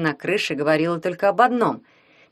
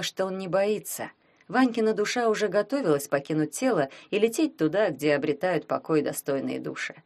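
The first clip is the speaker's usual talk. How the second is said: ordinary delivery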